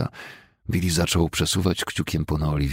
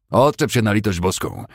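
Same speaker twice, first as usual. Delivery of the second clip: irritated snap; 5 semitones higher, 5 dB louder than usual. Acted. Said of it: "rzucił Fessenden".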